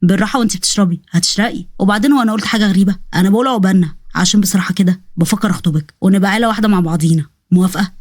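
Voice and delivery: low, calm suitor; fond male-role proposal; Osman proposing. Acted.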